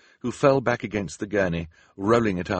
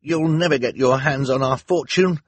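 loud, raised defiant retort; 7 semitones higher, 7 dB louder than usual. A narrator's reading. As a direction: stand up to the villain. Boy defies the villainess.

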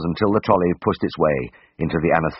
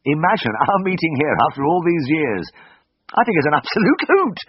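giggling; 10 semitones higher than usual. A narrator's reading. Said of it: "giggled uncontrollably".

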